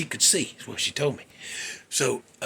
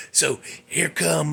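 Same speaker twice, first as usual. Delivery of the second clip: ordinary talk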